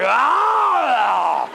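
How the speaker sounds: loud, high chopping yell; wood chopper shout. Shown as a demonstration. Wood chopper exercise at the mirror, loud shout. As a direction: yell as the axe comes down. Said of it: "Make growling noises and do wood chopper".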